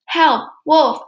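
high, loud cries; shouting for help. A narrator's reading. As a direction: cry out in alarm, word by word.

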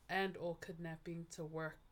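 normal talking voice

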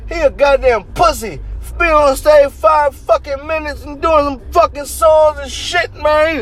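high-pitched mocking squawk of a ranting drunk; mock an angry concertgoer like a screeching parrot; squawking parrot-like heckler. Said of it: "he reminded me of a parrot".

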